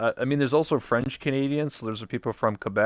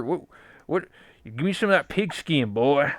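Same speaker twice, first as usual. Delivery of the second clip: Southern hillbilly; 4 semitones higher than usual. Retold or imitated. Hillbilly demanding pig skin, mock Southern accent. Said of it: "from the South, from America".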